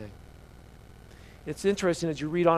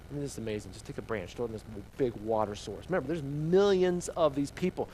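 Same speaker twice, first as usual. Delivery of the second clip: ordinary delivery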